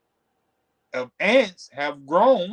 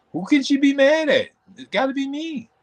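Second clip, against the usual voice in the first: rising anxious inner voice; 11 semitones higher than usual. Retold or imitated.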